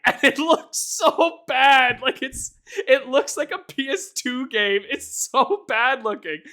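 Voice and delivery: laughing disbelief. Real, not acted. Laughing at ugly close-up.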